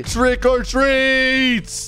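dragged-out, high trick or treat; very slow. Retold or imitated. Gamer leaving, one last slow plea to grandma. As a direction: draw each word out. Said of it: "I'm gonna say".

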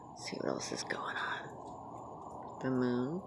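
slow hushed whisper; quiet whispered aside. Real, not acted. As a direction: keep it to a whisper. Whispering outdoors at night.

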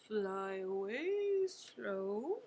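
drawn out, low and slow. Shown as a demonstration. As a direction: stretch every word out.

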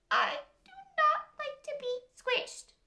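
high, put-out little fish; upset pufferfish voice. A narrator's reading. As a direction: squeak it out like an offended fish. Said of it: "said the fish".

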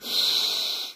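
long, breathy acted gasp; drawn-out storybook gasp; long shocked gasp. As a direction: draw in a long horrified breath. Characters shocked at broken toy.

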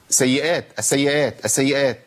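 السيئات repeated short, s and y not held; lightened doubled letters. Shown as a demonstration. Teacher repeating the mistake, السيئات with lightened doublings. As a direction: rush through the doublings of السيئات each time. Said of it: "أنا كده خففت الحرف المشدد".

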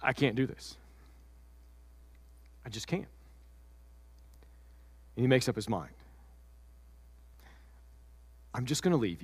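low, soft, resigned goodbye; heartbroken Joseph. Acted. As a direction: quietly give up on her. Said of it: "So Joseph says".

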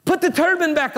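high ringing cry, as if he cannot hold it in; retold yell. Preacher voicing Zechariah calling out to the angels, a high excited yell. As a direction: burst out calling for the clean turban. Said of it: "He yells out".

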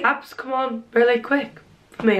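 usual speaking voice

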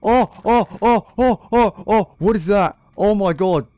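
high, loud panicked oh oh oh; scared screaming at a horror game; terrified shrieks. Real, not acted. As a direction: yelp in real fright at the scare.

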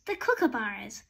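ordinary delivery

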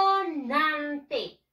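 "consonante" slowly chanted, each syllable held apart; syllable-by-syllable emphasis. Shown as a demonstration. Teacher giving the class the key answer, "consonante" in slow, stretched syllables. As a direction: draw out "consonante" syllable by syllable for learners.

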